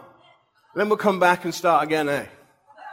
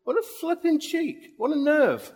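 indignant inner thought; 8 semitones higher, 5 dB quieter than usual. Retold or imitated. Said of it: "and I'm thinking".

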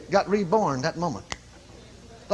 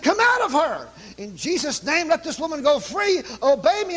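commanding rebuke; 9 semitones higher than usual. Retold or imitated. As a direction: order the devil out with full authority. Retold casting out a spirit.

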